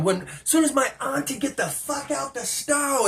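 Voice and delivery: high-pitched yell without the volume; mock scream. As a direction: imitate her screaming toward the store, held back. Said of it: "she screams it back towards".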